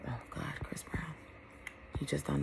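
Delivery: breathy whisper of longing; swooning whisper. Real, not acted. Gushing to viewers about her crush, whispered. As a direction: whisper his name like a crush. Swooning over [name].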